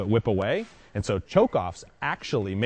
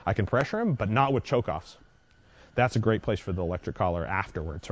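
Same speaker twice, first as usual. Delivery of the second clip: normal talking voice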